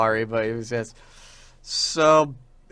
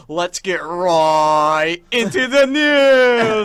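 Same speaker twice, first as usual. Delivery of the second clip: slow, louder mock YouTuber intro; news intro voice; 4 semitones higher, 9 dB louder, much slower than usual. Quoting his joke reply, slow mock news-host voice. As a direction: drag it out like a drama channel host. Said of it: "And I said".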